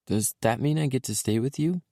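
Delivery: put-on high, eager little boy. A narrator's reading. Small boy telling dad his toys are at mommy's, little boy voice.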